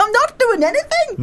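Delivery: high-pitched mock indignation; bewildered protest. Roommate in his bed answering her shouts, high protest.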